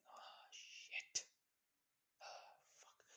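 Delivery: hushed, breathy curse under the breath; whispered dismay. Acted. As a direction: swear softly in a whisper. Deacon hears bike stolen.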